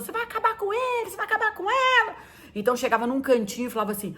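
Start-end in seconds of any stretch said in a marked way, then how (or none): 0.0-2.2 much higher mock-outraged chorus of friends; friends protesting. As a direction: scold the couple in a high voice. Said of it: "de apanhar das pessoas".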